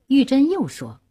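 ordinary delivery, nothing marked

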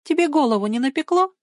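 high, teasing comeback; airy mocking question; light retort. A narrator's reading. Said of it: "легко отбила она".

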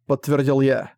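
plain reading voice

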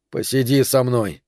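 ordinary talk

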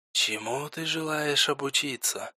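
soft, distant whisper from all around; a faint, far-off whispering voice; whisper from everywhere. A narrator's reading. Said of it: "Тихий шёпот отовсюду".

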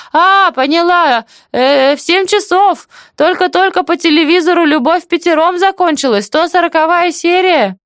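very high, animated village-aunt voice; chatty deaf auntie, remembering. Acted. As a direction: recall it eagerly, pitched right up. Story figure: aunt recalls the time.

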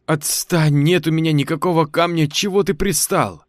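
yelling while pulling free; struggling against restraints. Acted. Boy struggles in shaman's grip.

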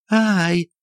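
high sharp cry; frightened scream. Acted. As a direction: shriek as the weight flies. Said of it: "опять закричала Софья Антоновна". (высокий испуганный вскрик)

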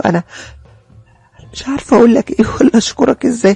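tearful. Acted. Dorra thanking the stranger, lightly tearful. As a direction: thank him through mild tears. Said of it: "والدموع مغرقاني".

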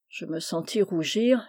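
usual speaking voice

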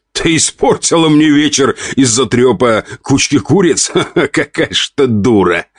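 high-pitched, breaking into laughter; scornful husband groaning through laughter; laughing groan. A narrator's reading. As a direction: laugh helplessly at her stupidity. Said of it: "граф в голос рассмеялся".

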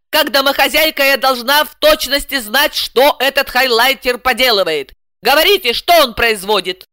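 high-pitched, insistent housewife; demanding. A narrator's reading. Client questioning translator about her opponent, demanding. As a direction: press firmly for an answer.

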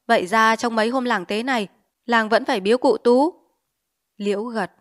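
plain reading voice

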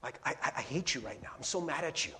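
low-volume retold grumbling; acted-out grumble under the breath; cursing under breath. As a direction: mimic private cursing, kept soft. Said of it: "cursing them under my breath".

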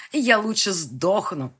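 loud, slow furious cry; girl fighting her attacker, screaming; desperate scream. Acted. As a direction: scream it while clawing at him.

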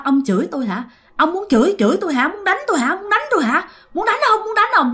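mock possessed son daring his father to hit him; furious challenge. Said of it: "anh mới chửi nó".

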